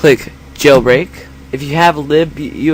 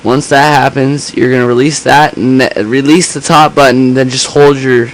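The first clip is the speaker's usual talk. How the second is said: louder, more echoey camera mic; camera mic sound; 4 dB louder than usual. Real, not acted. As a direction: speak into a sensitive camera mic. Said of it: "the camera mic's a bit s-, too sensitive".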